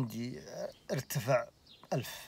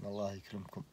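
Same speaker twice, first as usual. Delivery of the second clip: ordinary delivery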